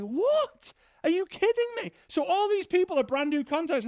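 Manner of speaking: very high, stunned dad; dad's voice shooting way up in shock. Retold or imitated. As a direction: gasp it out as the shocked father.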